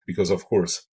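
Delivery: ordinary talk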